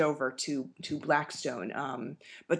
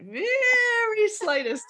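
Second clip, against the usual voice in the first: "very slightest" drawn out slow, much higher and louder; stress on very slightest; 5 dB louder, much slower than usual. Shown as a demonstration. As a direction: stretch out "very slightest" high and loud. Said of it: "the very slightest bit of a Boston accent".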